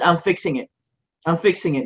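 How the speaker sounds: normal talking voice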